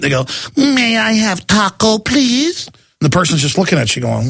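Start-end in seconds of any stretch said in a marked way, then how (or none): 0.6-2.7 high, slow, over-careful caricature; mock gringo diner. Gringo ordering at a Mexican restaurant, exaggerated mock voice. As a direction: draw out each word as a clueless restaurant customer. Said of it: "gringos or whatever".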